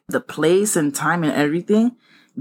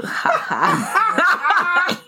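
high burst of laughter; 12 semitones higher than usual. Real, not acted. Laughs at the devil emoji reply.

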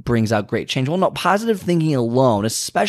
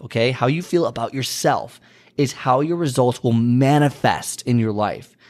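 usual speaking voice

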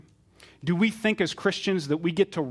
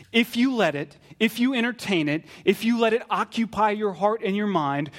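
raised pitch, insistent preaching; urgent warning; 6 semitones higher than usual. Acted. Jesus pleading with listeners about the thief, urgently warning. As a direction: press each condition home, higher and emphatic.

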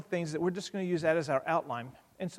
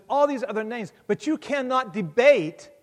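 emphatic preaching; 6 semitones higher, 7 dB louder than usual. Acted. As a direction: hammer each word home. Preaching peak.

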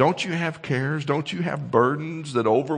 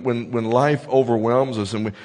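normal talking voice

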